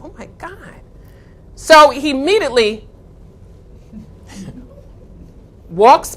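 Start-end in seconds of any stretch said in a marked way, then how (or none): 0.0-0.8 stunned whisper. Retold or imitated. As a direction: barely breathe it out in shock. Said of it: "We said".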